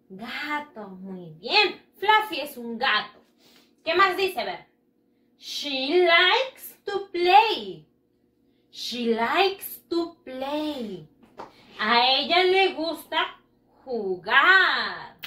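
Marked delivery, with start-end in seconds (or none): 5.4-11.1 very slow, word by word; slow model reading. Shown as a demonstration. Teacher modelling the sentence slowly for pupils. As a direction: stretch each word out for learners. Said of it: "repitan después de mí".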